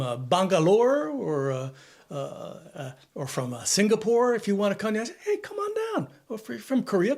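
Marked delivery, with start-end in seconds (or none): 4.9-6.1 game show host. Acted. Mock invitation to skilled migrants.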